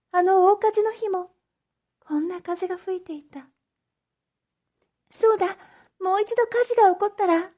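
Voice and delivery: put-on high girlish voice, rising and racing; a girl talking herself into something terrible; obsessed young girl. Oshichi plotting a fire to see Kichisaburo, high and fast. Oshichi's feverish inner thought.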